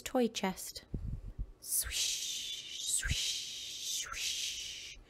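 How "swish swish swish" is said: breathy swish sounds, drawn out; whispered swishing. A narrator's reading. Toy chest noises in the night, whispered swishes. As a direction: let each swish hiss out slowly.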